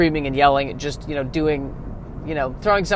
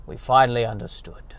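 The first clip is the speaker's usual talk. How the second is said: mystic elder sage; 4 dB louder than usual. Acted. Reverend Mother tells the child podcasting's cosmic history, hammed-up. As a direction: intone it like an ancient oracle, then wail.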